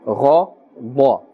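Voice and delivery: ghada in two separated syllables, dark and rounded; thick, full-mouthed tone. Shown as a demonstration. Teacher corrects the mistake, slow thick ghada. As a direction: split ghada, each half heavy and full-mouthed. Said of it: "pronounced with a thick tone".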